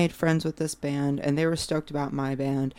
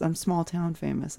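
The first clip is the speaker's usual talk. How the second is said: usual speaking voice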